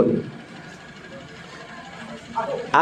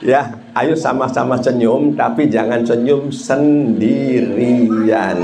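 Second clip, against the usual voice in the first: usual speaking voice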